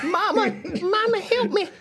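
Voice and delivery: put-on very high little-kid plea; hammed-up small child in distress; toddler impression. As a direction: cry for mama like a frightened toddler. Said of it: "my great impression of the toddler".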